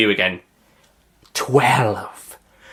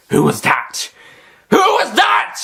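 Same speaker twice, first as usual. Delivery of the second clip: shouted demand; 5 dB louder, slower than usual. Acted. Master demands identity.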